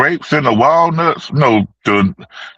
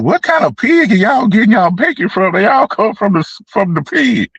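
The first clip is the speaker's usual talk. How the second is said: incredulous; 9 semitones higher than usual. Retold or imitated. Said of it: "I'm like".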